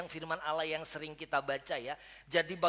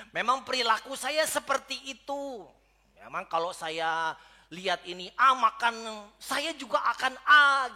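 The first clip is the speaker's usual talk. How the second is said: defensive excuse; 10 dB louder than usual. Retold or imitated. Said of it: "kita bilang begini".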